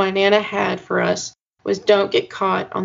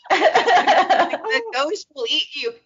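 mock ominous, laughing; 5 semitones higher, much slower than usual. Mock-spooky reread of Heather's quote.

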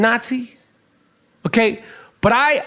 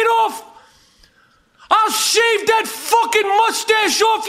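furious shout; 9 semitones higher than usual. Acted. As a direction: bellow it right in his face. Ranting at imagined Nazis.

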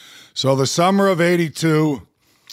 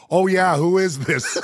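laughing in disbelief; 5 semitones higher than usual. Retold or imitated. Asks caller, laughing in disbelief.

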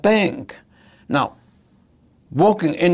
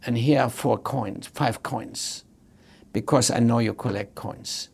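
soft personal teller; 4 semitones lower, 6 dB quieter than usual. Retold or imitated. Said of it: "she would have said".

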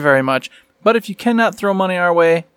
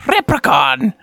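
mock Irish; 5 semitones higher, slower than usual. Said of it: "do an accent anymore".

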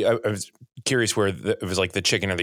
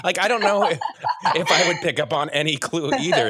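high-pitched, laughing questioning; laughing, incredulous and animated; amused disbelief; 8 semitones higher than usual. Real, not acted. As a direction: puzzle it out through laughter. Amused disbelief at subtle hints.

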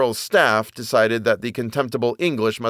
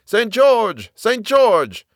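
high rallying shout, falling at the end; 11 semitones higher, 4 dB louder, much slower than usual. A narrator's reading. Thousands answering the soldier's summons, shouting. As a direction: shout the saint's name as a battle cry. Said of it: "thousands shouting".

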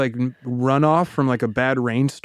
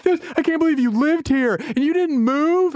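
much higher, whiny brat; jerk kid voice; 12 semitones higher than usual. Retold or imitated. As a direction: whine it like a five-year-old brat. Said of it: "I'm like a jerk kid".

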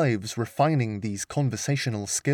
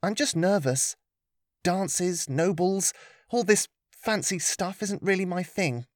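put-on higher, nervous young girl; 6 semitones higher than usual. Lillian's nervous voice.

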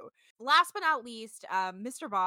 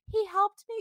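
a lightly voiced little girl, pitched up; a touch of small, sad and sweet; hint of a little girl's sad, sweet voice; 9 semitones higher, 3 dB louder than usual. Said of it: "little sad, sweet voice".

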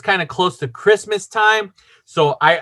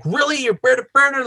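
imitated indignant outburst, much higher; mad outburst; 8 semitones higher, faster than usual. Boyfriend breaks his silence on the call, mad. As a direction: blurt it out in retold anger, pitch jumping up. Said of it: "I was mad".

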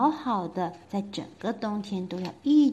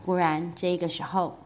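plain reading voice

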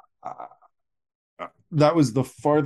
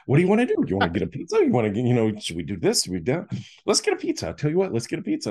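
much higher, eager puppyish pitch with a fast finish; hyper puppy; 7 semitones higher, much faster than usual. Retold or imitated. Younger brother pestering about pizza, hyper puppy voice. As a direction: bounce it like an overexcited puppy. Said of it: "super hyper, like a little puppy".

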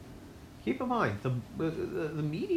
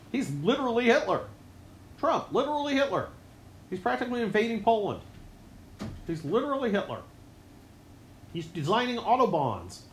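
mocking refrain; 7 semitones higher than usual.

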